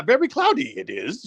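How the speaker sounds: high-pitched imitation of the Jedi master; Yoda. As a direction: put on a high little sage's voice. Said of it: "that's basically Yoda's way of saying".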